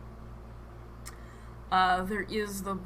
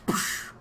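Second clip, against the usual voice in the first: imitated splash, "psh"; voiceless splash at the bottom of a fall; splash sound. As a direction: make a short hissy splash with the mouth. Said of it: "as he falls in a".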